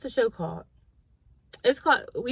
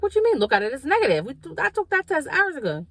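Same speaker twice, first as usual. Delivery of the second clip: disbelief; 9 semitones higher, 4 dB louder than usual. Retold or imitated. Arguing that the test was negative.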